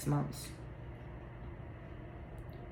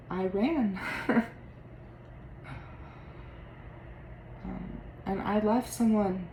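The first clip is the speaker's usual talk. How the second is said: tearful; 4 dB quieter than usual. Acted. Actor rereading the line, now tearful. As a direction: voice thick with held-back tears. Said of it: "I don't know why I'm crying".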